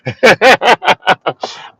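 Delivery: loud high triumphant laugh. Real, not acted. Laughing at the viewer after being right, loud high laughter.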